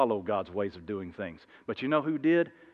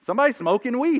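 stranger explaining; 11 semitones higher, 6 dB louder than usual. Retold or imitated.

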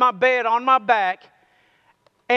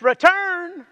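drawn-out high Return; read out; 7 semitones higher than usual. Shown as a demonstration. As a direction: stretch out Return from high to a fall. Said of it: "Let's say that together".

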